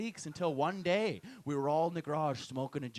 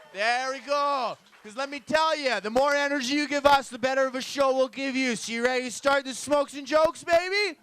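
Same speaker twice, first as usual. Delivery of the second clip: loud, high hype-man call to the crowd; loud emcee energy building the crowd; hype host; 8 semitones higher, 8 dB louder than usual. Real, not acted. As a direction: pump up the room. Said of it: "you guys got better energy".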